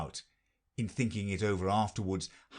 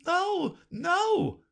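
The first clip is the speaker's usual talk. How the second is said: very high, louder queen cry; 4 dB louder than usual. A narrator's reading. Queen urges Alice at full speed, high loud cry. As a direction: spur her on at the last stretch. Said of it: "cried the queen".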